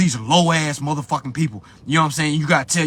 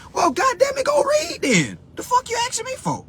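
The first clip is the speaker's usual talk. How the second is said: very high-pitched exasperated comeback; mock-outraged high whine; exasperated retort. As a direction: fire back in a squeaky, fed-up pitch. Retorts to people asking why charge.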